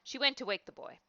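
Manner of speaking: ordinary delivery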